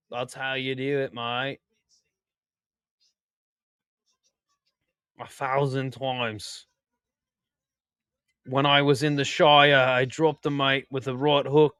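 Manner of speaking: light mock Australian accent. Joking to Australian chat, lightly put-on Australian accent. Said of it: "That's, like, Australian".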